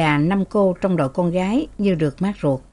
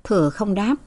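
ordinary delivery, nothing marked

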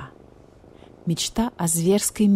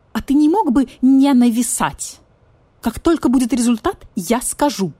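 annoyed; 6 semitones higher, 5 dB louder than usual. Acted. Badger irritated by hovering vulture.

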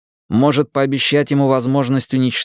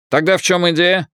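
put-on Irish accent; 7 semitones higher than usual. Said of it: "Ирландский акцент работал на всю катушку".

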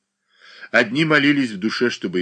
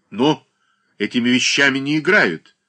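louder, firm and weighty; impressive, weighty; 5 semitones higher, 6 dB louder than usual. A narrator's reading. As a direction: lay it down with authority. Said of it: "внушительно произнёс Данила Захарыч".

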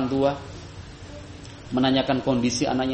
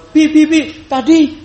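put-on high, loud excited child calling Mum; a little kid blurting it out first; eager child voice, pitched way up and loud; small child; 11 semitones higher, 10 dB louder than usual. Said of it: "anak kita yang kecil kemudian duluan".

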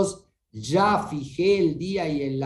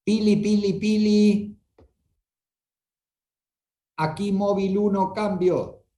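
crackly walkie-talkie dispatch call; police radio; 4 semitones higher than usual. Pretend. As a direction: call it in like a patrol car radio. Mock radio call to Pili.

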